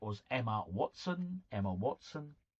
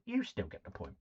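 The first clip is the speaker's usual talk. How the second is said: normal talking voice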